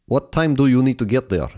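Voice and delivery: imitated Polish accent. Cab driver asking Vaughan the time, in a Polish accent. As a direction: put on a Polish accent, a tough cabbie. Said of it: "He was like the Polish James Bond".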